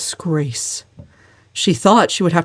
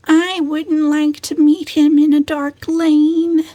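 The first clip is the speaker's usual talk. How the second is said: much higher, slow, quavering fear; shivering; 10 semitones higher, slower than usual. A narrator's reading. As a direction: shudder at the thought. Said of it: "shivered cousin Georgiana".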